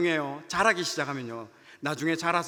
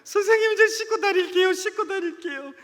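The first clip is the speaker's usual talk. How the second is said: much higher crying plea, fading at the end; imitating a sobbing kid; 13 semitones higher, 6 dB louder than usual. Student hugging teacher and crying, high wailing promise. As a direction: wail the promise like a weeping boy. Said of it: "대성통곡하는 거예요".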